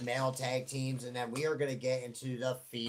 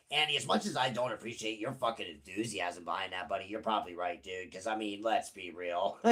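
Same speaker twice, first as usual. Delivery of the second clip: second voice; 6 semitones lower, 5 dB quieter than usual. Pretend. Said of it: "I do the two voices".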